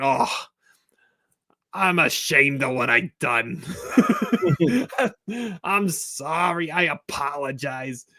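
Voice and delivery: slow, sheepish apology broken by laughter; a hammed-up shame with giggles; laughing Don Zimmer. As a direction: try to stay in character while cracking up. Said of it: "Horatio Sanz was Don Zimmer".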